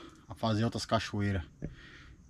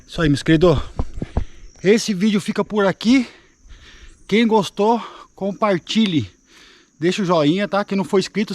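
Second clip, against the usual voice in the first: higher, louder sign-off to the viewers; youtuber outro; 4 semitones higher, 7 dB louder than usual. Acted. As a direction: hype up the subscribe and like request. Loud upbeat channel sign-off.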